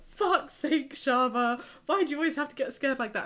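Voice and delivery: high, loud, fast mock exasperation; imitating an annoyed partner; exasperated boyfriend. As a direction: boyfriend on the phone, fed up and snapping.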